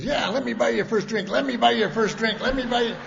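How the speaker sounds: high, loud taunting offer; eager heckling. Retold or imitated. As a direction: butt in loudly, repeating it insistently. Ralph butts in, waving a dollar.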